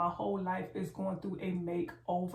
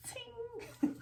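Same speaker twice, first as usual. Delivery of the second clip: high, squeaky laughing ting; playful ting; 5 dB quieter than usual. Pretend. Vlogger teases viewers about husband tingling, squeaky.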